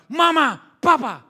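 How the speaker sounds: high, loud demanding yell; defiant teenager shouting. Acted. Teenager whose wishes were refused, loud high demanding yell. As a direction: yell at the parents for refusing you. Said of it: "Tapi setelah remaja".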